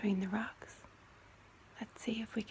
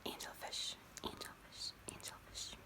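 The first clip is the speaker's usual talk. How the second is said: each angelfish softer than the last; fading whispered echo; 11 dB quieter than usual. Pretend. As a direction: breathe the word away three times.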